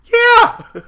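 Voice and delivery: very high, loud laughing shout; real whoop of agreement through laughter; excited agreement. Real, not acted. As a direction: burst out in delighted agreement. Delighted agreement with co-host's joke.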